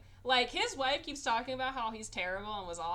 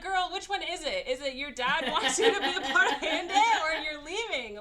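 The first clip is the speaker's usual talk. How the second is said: loud, high, laughing exasperation; exasperated; 8 semitones higher, 10 dB louder than usual. Retold or imitated. Viewer scolds a contradicting movie character, loudly exasperated. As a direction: demand an answer at high, raised volume.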